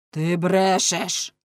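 slow, weighty accusation; interrupting accusation. A narrator's reading. Lamme interrupting the fishmonger's testimony, accusing. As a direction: cut in with a drawn-out charge of lying. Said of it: "перебив його Ламме".

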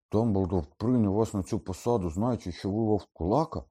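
loud, high, incredulous question; astonished. A narrator's reading. Ron learns the teachers know about Lupin, astonished. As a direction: ask it in open disbelief. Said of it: "здивувався Рон".

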